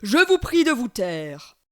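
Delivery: loud, slow booming command; thundering. Acted. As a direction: thunder the order. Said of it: "tonne le monstre".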